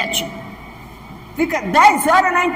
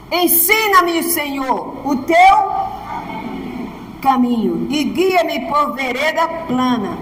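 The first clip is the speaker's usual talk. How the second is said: dramatic scripture reading; slower than usual. Acted. Reading psalm emphatically.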